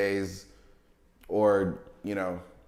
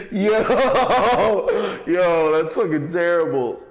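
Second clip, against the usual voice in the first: laughing; 9 semitones higher, 8 dB louder, much slower than usual. Real, not acted. Still laughing at the joke.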